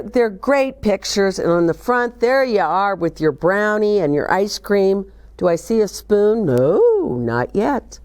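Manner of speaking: normal talking voice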